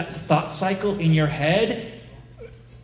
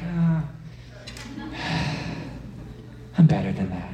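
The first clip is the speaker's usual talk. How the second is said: mock dejection; 4 dB quieter than usual. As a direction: sigh it out, acting let down. Imagined sigh over a failed cake.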